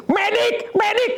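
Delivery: very high-pitched call for help; mock calling out. The call the referee never made, high mock shout for medics. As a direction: imitate summoning the medics. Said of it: "Dia kagak panggil".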